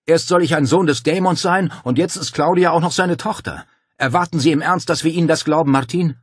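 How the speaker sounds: loud, high-pitched incredulous protest; indignant roar. A narrator's reading. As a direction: keep roaring in outraged disbelief. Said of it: "brüllte er".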